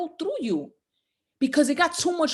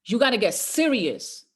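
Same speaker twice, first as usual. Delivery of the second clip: usual speaking voice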